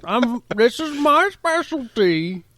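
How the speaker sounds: high, slow, hammed-up comedian voice; Bill Cosby. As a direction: drag it out in a silly celebrity impression. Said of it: "This is what Bill Cosby thought".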